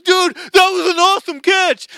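loud, high, slurred cheering shout; drunk fan yelling. Retold or imitated. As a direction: bellow praise like a tipsy fan. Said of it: "probably half drunk".

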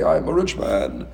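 slow, puffed-up boast as a pretend rich man; mock rich man. Poor man at the Seder pretending, slow mock boast. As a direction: lean back and play the rich man, slowly. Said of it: "you're gonna say".

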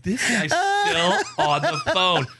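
high, slow, drawn-out amazement; high-pitched incredulous aside; mock disbelief. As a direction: marvel aloud, stretching every word. Mock disbelief with caller listening.

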